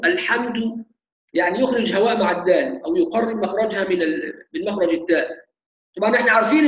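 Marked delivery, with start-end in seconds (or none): 0.0-0.8 "الحمد" said "al-hamtu", air after the last consonant; dal as ta. Shown as a demonstration. Teacher repeats the mistake for students, الحمد ending in tu. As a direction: swap the final du of "الحمد" for tu. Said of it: "بدل ما يقول دو يقول تو".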